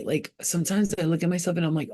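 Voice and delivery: ordinary talk, nothing marked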